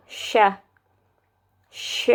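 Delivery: soft hushed "sh"; sh sound. Shown as a demonstration. Vlogger demos Indonesian sh sound. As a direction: breathe out a long "sh". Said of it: "it would become the same".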